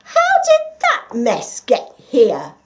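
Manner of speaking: loud, slow furious demand. A narrator's reading. Badger finds rubbish in her living room, furious. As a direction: rage at the mess. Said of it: "Badger was furious".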